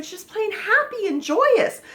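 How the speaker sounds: high, gleeful burst; happy and joyous. Shown as a demonstration. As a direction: say it bursting with joy. Acts out the feeling named.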